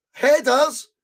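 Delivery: sudden high call of a chance; high excited shout. Real, not acted. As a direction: blurt it out as the ball comes in. Fan commentator reacts to chance.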